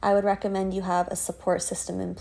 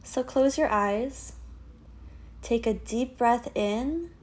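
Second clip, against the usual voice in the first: guided meditation. Acted. Yoga teacher leading listeners through a dark tunnel visualization, guided meditation. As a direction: guide them gently inward, leaving space after each phrase.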